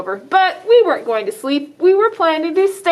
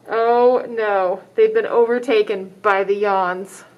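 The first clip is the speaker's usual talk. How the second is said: usual speaking voice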